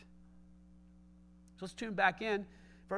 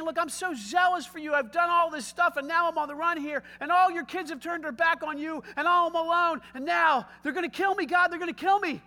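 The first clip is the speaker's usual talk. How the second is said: loud, higher, hammed-up complaint; mock panic; 7 semitones higher, 7 dB louder than usual. Elijah pleading with God, loud mock panic. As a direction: rattle off the woes in a frantic rush. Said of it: "But he's saying".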